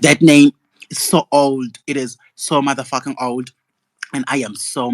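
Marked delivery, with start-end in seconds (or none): 0.0-1.8 loud outburst. Real, not acted. Repeats the rant, louder.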